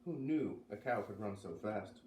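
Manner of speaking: ordinary delivery, nothing marked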